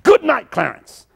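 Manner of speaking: high ringing preacher's call; preacher yelling goodbye over the casket; yelled farewell. Retold or imitated. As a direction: sing out the goodbye, high and strong. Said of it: "And he yelled".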